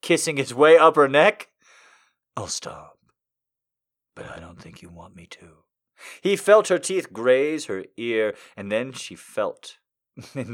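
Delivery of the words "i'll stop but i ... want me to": low, soft seductive man; seductive whisper. Acted. Seductive male voice.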